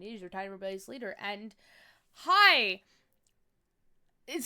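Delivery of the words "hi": shouted cheerful hi; sudden loud greeting to viewers. Real, not acted. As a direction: burst out with a loud hello. Bursts into a greeting.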